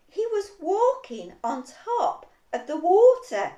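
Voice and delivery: ordinary delivery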